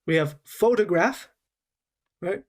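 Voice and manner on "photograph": "photograph" with the first syllable PHO stressed. Shown as a demonstration. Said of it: "we're stressing different parts of this word".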